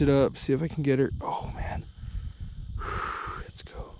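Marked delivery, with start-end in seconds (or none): 1.2-3.9 breathy whispered sigh and hope. Real, not acted. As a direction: murmur it to yourself, barely voiced.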